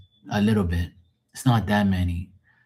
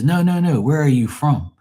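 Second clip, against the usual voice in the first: higher, louder mock-incredulous questioning; retold insistent disbelief; 7 semitones higher, 4 dB louder than usual. DJ questioning where he's from, retold insistent disbelief. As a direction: imitate someone pressing for the real answer. Retold DJ doubting his origin.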